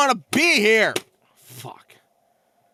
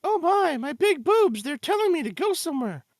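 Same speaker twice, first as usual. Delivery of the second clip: put-on ditzy girl voice; 8 semitones higher than usual. Voicing girl character.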